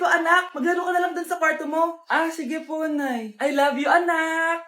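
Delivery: normal talking voice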